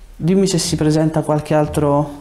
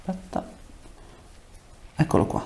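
ordinary talk, nothing marked

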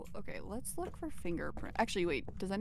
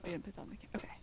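whispering; 10 dB quieter, much faster than usual. Real, not acted. Hiding from ghost, whispering.

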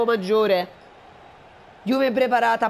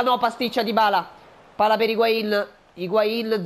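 ordinary delivery

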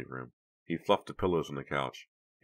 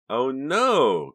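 shocked gasp, much higher and louder; 10 semitones higher, 12 dB louder than usual. A narrator's reading. Karina sees the lipstick, gasping in shock. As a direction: catch your breath in shock. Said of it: "Karina gasped".

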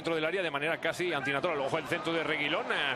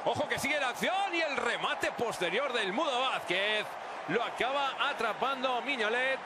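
excited shout; 7 semitones higher than usual. Real, not acted. Commentator calls a chance, shouting.

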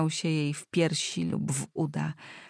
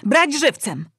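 high, loud urgent command; imperious cry in two sharp bursts; shouted correction; 7 semitones higher, 7 dB louder than usual. A narrator's reading. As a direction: yell a sudden counter-order. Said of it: "krzyczała królewska córka".

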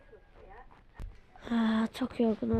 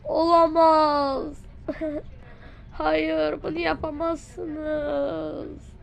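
dramatic despair; 2 semitones higher, much slower than usual. Acted. Melodramatic despair at villain.

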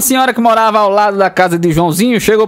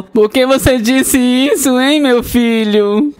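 slow questioning; 5 semitones higher, much slower than usual. Acted. Mother asks tenderly.

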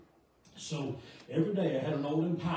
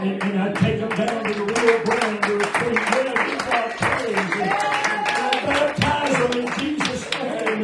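high, very loud revival preaching; full-force preaching; 8 semitones higher, 12 dB louder than usual. Real, not acted. Preacher tells congregation of revival and baptisms, high full-force preaching. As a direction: build to a loud, exultant pulpit shout.

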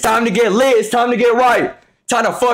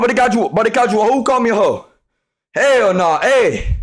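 ordinary talk, nothing marked

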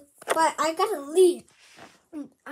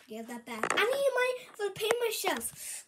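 usual speaking voice

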